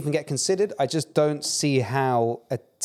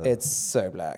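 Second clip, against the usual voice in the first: lower, slow, drawn-out art critic; a light pretentious murmur over a painting; mock art snob; 6 semitones lower, much slower than usual. Mocking chin-rubbing critics, lower and slow. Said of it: "chin rubbing brothers be like".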